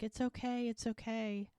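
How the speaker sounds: tender retold reassurance, softly lilting; soothing. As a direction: gently hush someone who is crying. Voicing a woman comforting crying girl.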